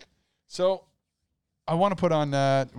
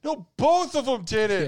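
mock verdict voice; 9 semitones higher, 3 dB louder than usual. Voicing the outcome as a character.

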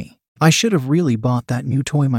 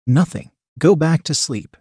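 ordinary delivery

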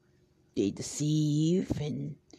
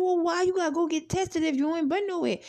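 high, quick questioning of a friend; retold challenge; 11 semitones higher, faster than usual. Asking girlfriend why she needs testing, challenging. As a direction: imitate yourself grilling a friend, pitched up and rapid.

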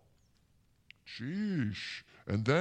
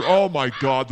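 sudden loud alarm at the bird; startled outburst; startled shout; 10 dB louder than usual. Real, not acted. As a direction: cry out as it swoops back.

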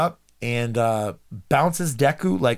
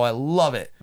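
ordinary delivery

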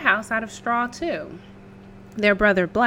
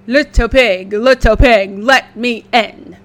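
wolf voice; 11 dB louder than usual. Acted. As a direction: call through the door like a hungry wolf. Wolf at the door.

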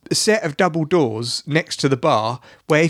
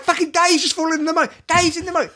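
high, urgent mock panic; retold screaming; 10 semitones higher, faster than usual. Shouting to the bar that a friend fell in, panicked. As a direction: raise the alarm in a strained high voice. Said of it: "I'm, like, screaming".

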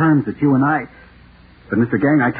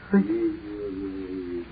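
sudden much softer, lower word; dread hush; 4 semitones lower, 10 dB quieter than usual. Acted. Naming the terrible thing to Mister Gang, in a dreading hush. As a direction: let the word sink to a fearful hush.

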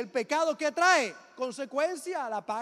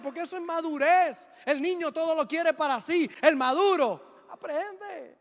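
louder preacher's cry; preaching; 3 semitones higher, 5 dB louder than usual. Acted. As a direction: proclaim it to the church. Preaching at full force.

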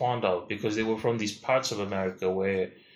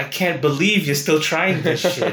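mock exasperated rebuke; 5 semitones higher, 4 dB louder than usual. Acted rebuke of returning missionary.

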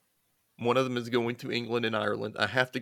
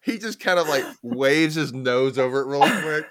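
higher and louder, giggly; laughing; 5 semitones higher, 8 dB louder than usual. Real, not acted. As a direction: laugh through the story. Laughing while telling.

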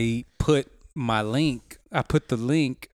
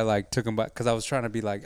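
normal talking voice

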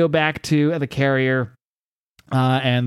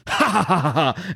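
mock evil cackle; villain cackle; 4 semitones higher than usual. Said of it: "Now I'm into it".

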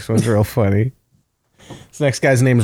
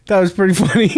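laughing; much faster than usual. Real, not acted. Laughing at own joke.

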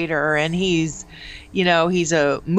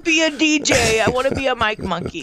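higher, put-on eager whine; pitched-up parody of a wannabe DJ; mocking the old voice; 6 semitones higher than usual. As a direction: tease him by parroting his old voice. Co-host mocks the eager old voice.

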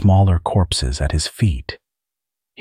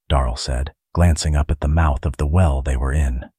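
plain reading voice

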